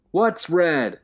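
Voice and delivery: loud, high insistent yell. A narrator's reading. Will yelling down insistently. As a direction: yell it, demanding. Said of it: "Will yelled insistently".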